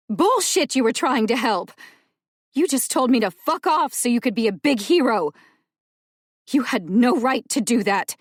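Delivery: loud, high, cutting fury; angry shout. Acted. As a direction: tear into him, no holding back. Rowan raging at Dell.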